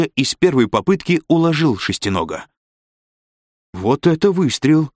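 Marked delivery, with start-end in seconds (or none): 3.7-4.9 higher-pitched admiring exclamation; thrilled, pitch jumping up; delighted cry. A narrator's reading. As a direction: cry out in delight, not a full shout. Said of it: "восторженно крикнула Корделия".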